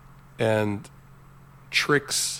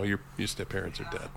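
flat quiet Terminator; 3 semitones lower, 10 dB quieter than usual. Retold or imitated. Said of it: "hangs up the phone and says".

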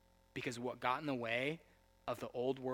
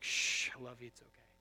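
tender hush. Retold or imitated. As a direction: soothe him like a child. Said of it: "he's like".

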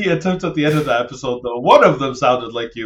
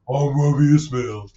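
second stab at a bad impression; loosely imitated colleague introducing himself; Rami Ismail. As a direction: lightly imitate your co-host, a bit lazily. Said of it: "is that closer?".

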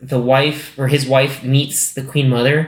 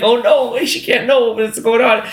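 higher mock panic, slowing on the second line; panicked; 9 semitones higher, slower than usual. Lionel panicking as his wife comes home, mock high panic. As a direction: squeal it out like a man caught by his wife. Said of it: "It's just like".

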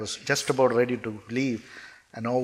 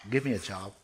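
lower, softer plea; subdued request; 4 semitones lower, 6 dB quieter than usual. Retold or imitated. Man tells God all he wants, subdued request. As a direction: ask it softly, dropping the pitch.

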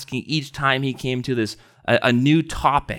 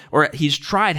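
usual speaking voice

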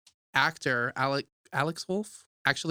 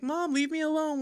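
high-pitched mock pleading; lightly acted, high-voiced protest; retold panicked plea; 13 semitones higher than usual. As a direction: imitate a son begging his mother off. Voices son fleeing his mom.

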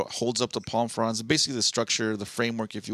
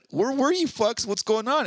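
exasperated; 9 semitones higher than usual. Retold or imitated. Confronting friends who returned late.

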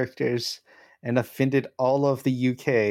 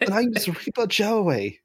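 high, slow, hammed-up Philly accent; heavy Philly accent; 7 semitones higher, slower than usual. Player voicing Reaper Joey's name, heavy Philly accent. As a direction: introduce the character in a mock Philadelphia accent. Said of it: "like, a heavy Philly accent".

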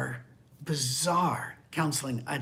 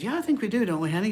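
higher, eager spouse voice; cheery spouse; 6 semitones higher, 3 dB louder, much faster than usual. Retold or imitated. Pastor imitates spouse's sweet agreement, higher. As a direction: answer sweetly to each other. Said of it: "And one of them said".

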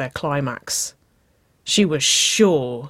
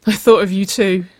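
higher, louder, insistent admission; urgent confession; 5 semitones higher, 8 dB louder than usual. A narrator's reading. Waverley admitting her feelings to Nicole, higher and urgent.

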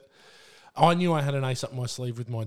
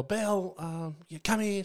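mild mock call, pitched higher; calling the daughter from downstairs, lightly; retold call upstairs; 5 semitones higher, slower than usual. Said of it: "she yells at the bell".

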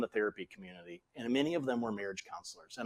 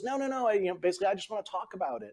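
higher-pitched imitation of a pleading wife; mock protest, pitched up, wanting to be heard; frustrated wife; 7 semitones higher than usual.

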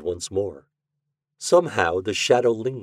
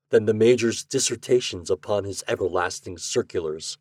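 plain reading voice

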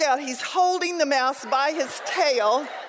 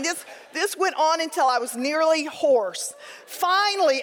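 ordinary talk

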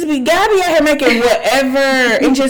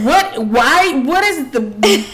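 higher, louder mock panicked questions; retold panic; 4 semitones higher, 4 dB louder, much slower than usual. Cohost retelling her panic at a caterpillar photo, mock panic. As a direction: relive the freak-out. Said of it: "I was like".

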